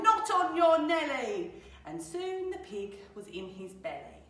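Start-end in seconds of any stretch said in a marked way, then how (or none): 0.0-1.6 loud, high-rising scornful refusal; emphatic storybook wolf; wolf's refusal. Acted. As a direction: belt out the wolf's answer. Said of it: "The wolf replied".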